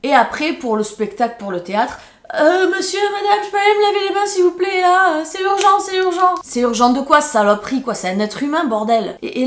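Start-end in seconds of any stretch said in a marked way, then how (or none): 2.3-6.3 put-on high, loud, fast pleading child; whiny disgusted kid. Boy begging teacher to wash hands after touching her, high and loud. 6.4-9.2 loud indignant outburst; outraged. Real, not acted. Vlogger furious at the bully, loud outrage.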